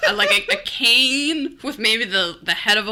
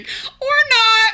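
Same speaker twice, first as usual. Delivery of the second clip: high, loud comic refusal; mock alarmed refusal; 7 dB louder than usual. Homeowner turning away repairman at the door, mock alarm. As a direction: call it out high through laughter.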